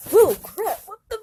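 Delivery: startled yelps. Acted. Chased while running.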